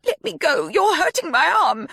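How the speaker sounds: panicked plea. A narrator's reading. Mia begging Sadie to let go. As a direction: struggle against her grip.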